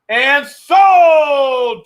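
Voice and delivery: loud, high auction call; excited shout. Real, not acted. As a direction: slam the sale shut with a shout. Auction closes.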